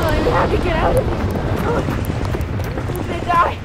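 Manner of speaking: loud frantic pleading; desperate yell. Acted. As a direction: shout at him to move. Pulling wounded partner out.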